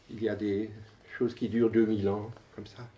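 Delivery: usual speaking voice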